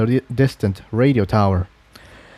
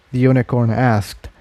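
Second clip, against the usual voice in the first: plain reading voice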